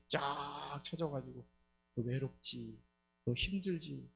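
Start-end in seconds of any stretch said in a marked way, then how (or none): 1.9-4.1 lower, softer sweet-talking visitor; soft coaxing demon. Retold or imitated. Demon whispering to a lonely wife, lower and softer. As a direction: lure her in gently, a hint of menace.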